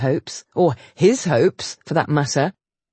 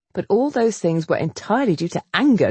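usual speaking voice

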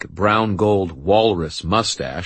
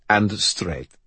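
put-on higher French-accented aside; 4 semitones higher than usual. A narrator's reading. Brother adds to his explanation, French accent. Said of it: "said in his French accent".

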